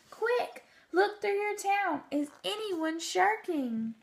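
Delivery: an anxious elephant asking the mayor; Horton calling back. A narrator's reading. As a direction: Horton checking on the Whos, high and urgent. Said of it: "And Horton called back".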